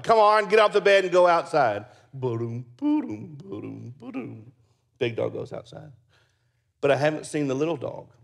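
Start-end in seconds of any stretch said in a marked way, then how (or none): 0.0-1.9 loud, high coaxing command; urging the dog. Retold or imitated. Ordering dog off the bed. 2.1-4.5 rhythmic ba-doom thud noise; mock thumping footsteps. Dog thumping off the bed.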